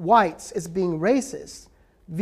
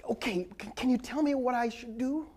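whispered plea; 3 semitones higher, 9 dB quieter than usual. Retold or imitated. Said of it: "whites in the audience who will".

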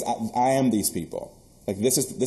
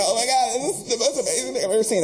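high, laughing gush of amazement; overjoyed gush; 12 semitones higher than usual. Retold or imitated. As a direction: gush like you just found treasure. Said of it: "I'm just like".

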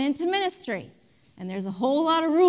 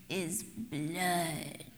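low, hushed vampire voice; vampire accent; 9 semitones lower, 14 dB quieter than usual. Acted. Preacher teasing the congregation with a vampire accent. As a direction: draw the word out like a soft, creepy vampire. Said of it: "say it with that vampire accent".

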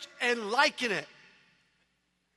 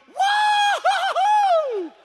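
very high, long, loud whoop; ecstatic whoop; 9 dB louder, much slower than usual. Acted. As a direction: whoop like a fan standing on the pew. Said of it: "standing on the pew in church going".

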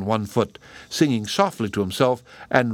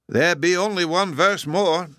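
sad; 9 semitones higher than usual. A narrator's reading. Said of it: "the gardener sadly replied".

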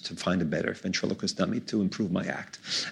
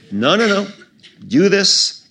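higher, louder, emphatic dismissal; insistent; 11 semitones higher, 7 dB louder, slower than usual. Retold or imitated. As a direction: wave the objection away, loudly. Capra brushing off objections.

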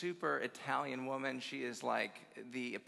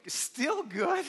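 high, loud mock disbelief; incredulous; 12 semitones higher, 8 dB louder than usual. Grandchild reacting to Grandma's verdict, hammed-up incredulity. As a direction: throw it back as an outraged question.

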